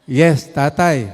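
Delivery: put-on slow, loud child's reply; loud, drawn-out kid's yes; voicing the grandchild. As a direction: play the little grandchild answering grandpa. Grandchild's answer voiced.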